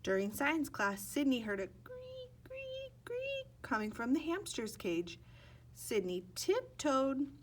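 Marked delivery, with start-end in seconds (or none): 1.8-3.5 very high, soft squeaky creaks. A narrator's reading. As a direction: squeak each creak like a rusty hinge. Sound effect in story.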